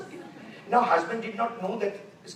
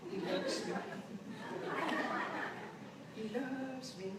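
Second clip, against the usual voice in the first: dreamy murmur; 6 semitones higher, 11 dB quieter, slower than usual. Retold or imitated. Wife at mirror, counting petals.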